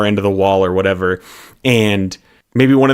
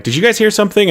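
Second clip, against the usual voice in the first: nervous question; 10 semitones higher, much faster than usual. Retold or imitated.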